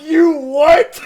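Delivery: high, loud laughing disbelief; shocked disbelief. Retold or imitated. Hearing the brother broke the lock, laughing disbelief. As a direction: choke out the shock through laughter. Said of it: "I'm like".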